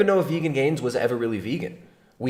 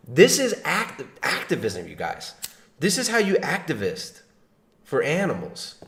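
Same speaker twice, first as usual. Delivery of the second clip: ordinary talk, nothing marked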